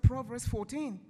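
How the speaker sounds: loud, emphatic retold divine call; voice from above. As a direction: announce it firmly as the calling voice. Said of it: "the voice said".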